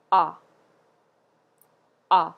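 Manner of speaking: "A" said as an open "ah"; French word à. Shown as a demonstration. Teacher demonstrating the accented preposition, open French vowel. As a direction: model "A" like a doctor's "ah", then leave a gap. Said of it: "word is pronounced".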